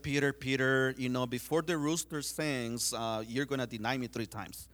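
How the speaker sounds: a teacher gently correcting his friend; calm foretelling. Acted. Jesus answers Peter's boast.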